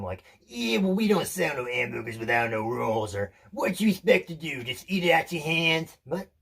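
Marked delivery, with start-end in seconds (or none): 0.5-3.1 loud, put-on vendor voice; gruff burger seller. Burger seller refusing an odd order, loud indignant voice. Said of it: "some kind of reaction from him". 3.5-6.0 loud, high put-on vendor voice; indignant burger seller. Burger seller challenging a customer who wants no roll, loud indignation. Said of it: "some kind of reaction from him".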